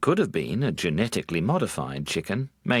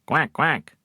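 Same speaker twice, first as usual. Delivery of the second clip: duck quack; 8 semitones higher, 4 dB louder than usual. Acted. Duck sound again.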